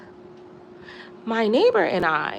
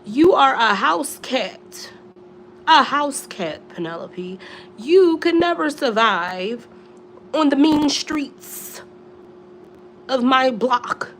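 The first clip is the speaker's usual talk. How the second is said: mock lecture; 4 semitones higher, 4 dB louder than usual. Scolding her cat Penelope.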